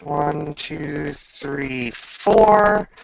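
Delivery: normal talking voice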